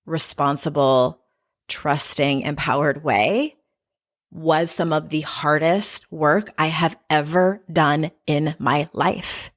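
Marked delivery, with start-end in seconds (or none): 4.3-9.5 slow, weighted, word-by-word emphasis; drawn out for emphasis, deliberate to the last word; emphatic. Real, not acted. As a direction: land each word of "hardest work I have ever done" slowly and firmly. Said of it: "I say that with emphasis".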